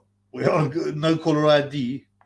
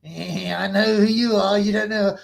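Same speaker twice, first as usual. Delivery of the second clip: imitating caller; 6 semitones higher, 4 dB louder, slower than usual. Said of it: "He goes".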